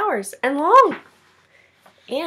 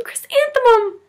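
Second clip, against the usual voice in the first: higher, louder blissful name; delighted; 13 semitones higher, 3 dB louder than usual. Acted. Chrysanthemum says her own name aloud in delight. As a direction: sing her name out happily.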